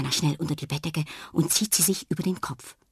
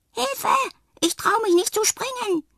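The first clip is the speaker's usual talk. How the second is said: very high, loud frightened cry; tiny clown cries for help; calling for help; 4 dB louder than usual. A narrator's reading. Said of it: "hört sie jemanden rufen". (hoch und ängstlich um Hilfe rufend)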